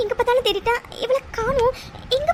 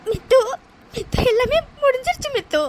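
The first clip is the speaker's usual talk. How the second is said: sobbing out the words; heartbroken, crying it all out; tearful, despairing; weeping; 3 semitones higher than usual. Acted. Heartbroken woman weeps.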